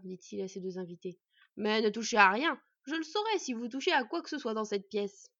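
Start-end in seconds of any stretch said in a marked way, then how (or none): 1.6-5.3 loud, emphatic order from the smith; raised voice laying down the rule; stern warning. Acted. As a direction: warn the guests off forcefully. Smith warns guests off his things.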